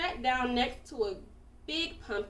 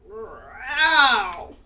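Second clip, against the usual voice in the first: monster growl; 3 semitones lower, 8 dB louder than usual. A narrator's reading. Scary sound in forest.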